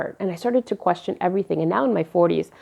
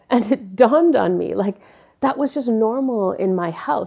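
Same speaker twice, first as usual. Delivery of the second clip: usual speaking voice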